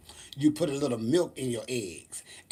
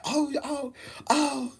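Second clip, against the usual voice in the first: much higher squeaky giggly oh; like overexcited kids; giddy kids; 11 semitones higher, slower than usual. Retold or imitated.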